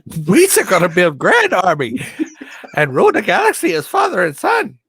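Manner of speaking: high, loud comic villain; Jar Jar-style Darth Vader. Acted. Vader promising rule to his son, high goofy alien. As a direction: proclaim it in a squeaky alien voice. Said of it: "talked like that".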